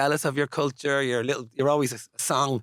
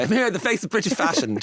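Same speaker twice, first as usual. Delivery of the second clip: higher-pitched, self-mocking grand announcement; ironic; 10 semitones higher than usual. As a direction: announce yourself with playful irony, pitched up. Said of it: "just in an ironic way".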